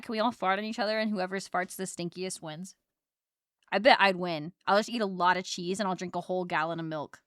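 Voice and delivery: normal talking voice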